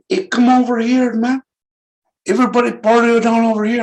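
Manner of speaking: quoting Jim Jones. Retold or imitated. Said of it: "He said".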